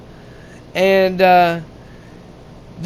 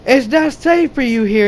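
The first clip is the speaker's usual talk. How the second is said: higher, put-on female voice; imitating the girl; 4 semitones higher than usual. Girl finding the men where it is unsafe, higher put-on voice. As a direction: voice the scolding girl a bit higher. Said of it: "she's like".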